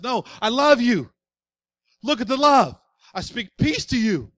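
loud, pleading voice of Jesus showing his wounds; impassioned Jesus. Acted. Jesus to Pete, showing his wounds, impassioned and loud. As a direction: preach it hard, as Jesus begging Pete to look.

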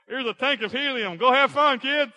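high, loud, hammed-up parent; carefree parent. Preacher plays parents handing kids helium, high loud cheer. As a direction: call it out like a breezy parent to the children. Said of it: "before our parents knew any better".

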